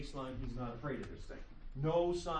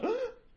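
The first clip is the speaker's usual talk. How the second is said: sudden high gasp, much higher; baby's startled gasp; 8 dB louder than usual. Shown as a demonstration. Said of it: "when a baby does the".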